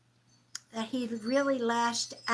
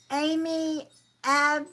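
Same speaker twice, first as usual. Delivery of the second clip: ordinary talk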